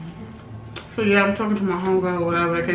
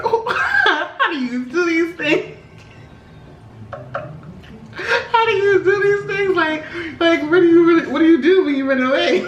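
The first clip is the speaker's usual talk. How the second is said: high-pitched, laughing disbelief; amused, incredulous questioning of yourself; laughing bewilderment; 9 semitones higher than usual. Retold or imitated. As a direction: pitch it way up, cracking up. Laughing at her clueless younger self.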